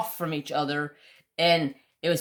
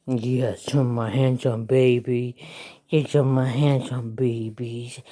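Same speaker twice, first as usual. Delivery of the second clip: doting pet talk; 4 semitones lower than usual. Real, not acted. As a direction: coo tenderly to a beloved dog. Cooing to her dog.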